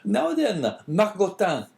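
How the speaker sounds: high, loud call to a dog; crying out. A narrator's reading. Innkeeper to his snarling dog, crying out. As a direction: cry out sharply in a high innkeeper voice. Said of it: "cried he, speaking to the dog".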